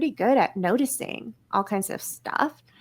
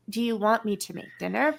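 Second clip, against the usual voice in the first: a low, softer question that hands off the job; grudging ask; 3 semitones lower, 6 dB quieter, faster than usual. Shown as a demonstration. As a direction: ask it like you don't want to do it.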